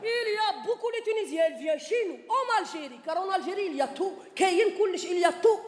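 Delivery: put-on Algerian accent. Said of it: "légèrement plus virulent". (accent algérien, fort et appuyé)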